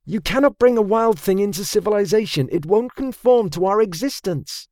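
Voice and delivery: high-pitched, insistent character voice; pressing. A narrator's reading. Saya argues against taming a wild horse, high and pressing. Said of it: "Saya pressed".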